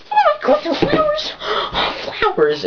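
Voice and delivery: squealing falsetto delight with a gasp; freaking out excitedly. Acted. Girlfriend getting surprise flowers, hammed-up ecstatic squeal. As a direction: imitate an overjoyed girl, extremely high and gasping. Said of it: "they'll freak out".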